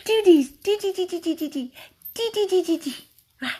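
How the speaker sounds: very high, rapid repeated syllables; high, excited play voice; playful dog chatter. Real, not acted. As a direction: egg the dog on with squeaky babble. Playing paws with dog.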